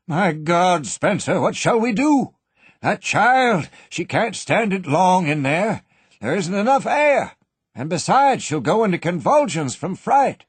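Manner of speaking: put-on desperate fear for the child; panicked old banker. A narrator's reading. Banker begging Spencer for help, panicked.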